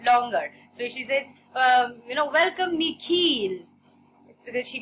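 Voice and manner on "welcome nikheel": "Nikhil" higher, slow, with a long "kheel"; American-style mispronunciation. Shown as a demonstration. Principal welcomes new pupil, "Nikhil" stretched to "Nik-heel". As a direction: draw out the end of "Nikhil" like "heel". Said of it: "it sounded longer".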